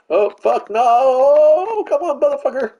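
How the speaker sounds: very high, loud cry of dismay; real gamer rage outburst, pitch sky-high; frustrated shout. Real, not acted. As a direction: yell at the game, stretching the "no". Yells as the game goes wrong.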